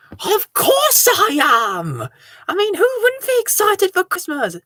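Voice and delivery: high, slow gushing yes; excited. Acted. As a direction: overplay the delight. Asked if excited for Christmas.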